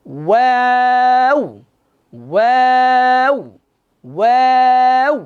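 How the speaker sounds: ওয়াও drawn out slowly, lips round first; slow ওয়াও from round to spread lips; waw drill. Shown as a demonstration. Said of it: "প্রথমে মুখ গোল হবে".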